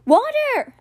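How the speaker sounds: high, loud desperate yell. A narrator's reading. Boy yells to conjure water, desperate. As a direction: shout the spell word with all force. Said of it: "Alex yelled".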